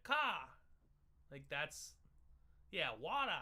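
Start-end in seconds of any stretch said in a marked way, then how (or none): 0.0-0.5 a hint of toddler chopping word ends; toddler speech. Shown as a demonstration. Explaining Boston speech, mock toddler cut word. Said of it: "toddlers, they don't say the ends". 3.0-3.3 put-on higher, cut-off toddler water, wɔt. Shown as a demonstration. Explaining Boston, toddler says Water dropped ending. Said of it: "that's how a three-year-old would say water".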